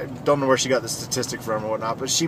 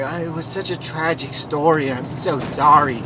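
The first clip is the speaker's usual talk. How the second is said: mock sympathetic senator; 3 semitones higher than usual. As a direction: imitate a senator gushing with put-on sorrow. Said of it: "she starts to say".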